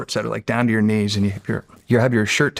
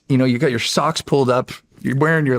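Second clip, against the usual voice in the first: ordinary delivery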